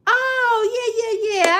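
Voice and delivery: high excited oh, laughing through the yeahs. Real, not acted. Recognizing a regular viewer, excited laughing cry. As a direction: burst out in recognition.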